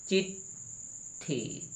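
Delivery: चिट्ठी whispered slowly; whispered correct form. Shown as a demonstration. Teacher modelling the corrected word softly, whispered. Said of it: "टा होना चाहिए".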